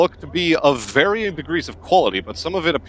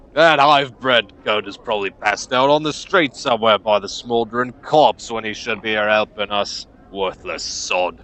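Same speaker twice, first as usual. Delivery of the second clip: scornful gruff foreman; 4 dB louder than usual. Acted. Foreman curses a worker passed out somewhere, gruff and scornful. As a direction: curse the shirker with contempt.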